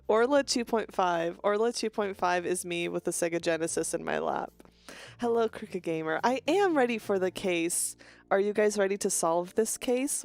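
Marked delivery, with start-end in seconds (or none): none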